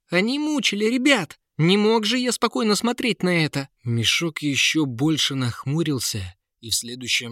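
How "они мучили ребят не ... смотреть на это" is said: put-on high, impassioned boyish outcry; heated protest. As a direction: protest hotly in a raised pitch. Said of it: "запальчиво крикнул принц". (запальчиво возмущаться)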